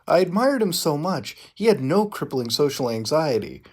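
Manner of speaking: ordinary talk, nothing marked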